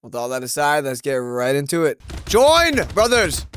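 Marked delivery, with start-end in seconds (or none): 2.3-3.5 mad scientist. Pretend. Villain voice over gameplay.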